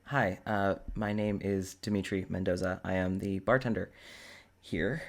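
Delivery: awkward, low-voiced introduction; Dimitri.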